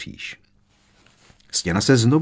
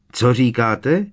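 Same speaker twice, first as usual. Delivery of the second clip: raised voice, higher and louder; loud question; 4 semitones higher, 5 dB louder, slower than usual. A narrator's reading. Harry questions the voices behind the veil, loudly. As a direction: call the question out to unseen voices. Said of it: "zeptal se tak hlasitě".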